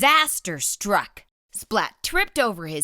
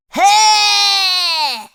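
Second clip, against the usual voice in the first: scary shout; 13 semitones higher, 11 dB louder than usual. Acted. Splat makes a scary face.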